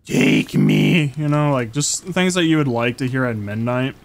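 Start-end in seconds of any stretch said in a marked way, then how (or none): 0.0-1.1 very low, creaky goblin voice; deep seductive goblin croak; mock-soothing goblin mask. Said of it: "nice and smoothing voice".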